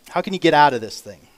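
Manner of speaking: ordinary delivery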